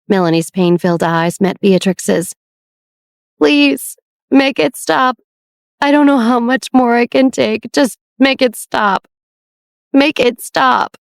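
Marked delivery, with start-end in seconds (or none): 3.4-11.0 higher, pleading voice, slowing on the last words; begging sister. Acted. Sick Melanie begging Beatrix to make the pain stop, higher and slow. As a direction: beg for it to end.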